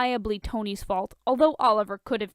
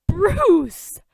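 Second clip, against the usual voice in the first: scandalized; 10 semitones higher, 12 dB louder than usual. Acted. Tony mock scandalized.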